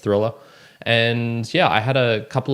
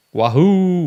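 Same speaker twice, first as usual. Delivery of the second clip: excited cheer; 11 semitones higher, 9 dB louder than usual. Real, not acted. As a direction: cheer again to restart the intro. Restarted intro cheer.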